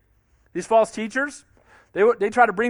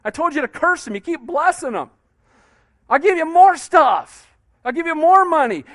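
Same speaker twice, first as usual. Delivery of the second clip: frustrated king; 5 semitones higher than usual. Retold or imitated. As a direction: lean on more like a desperate offer. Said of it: "Balak's trying to say".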